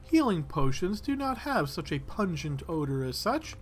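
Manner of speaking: high-pitched, dismissive apothecary voice; indignant older woman. Acted. Apothecary rejects adventurer's theory about her potions, indignant. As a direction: play a proper older woman scoffing at a theory. Game master plays the old woman.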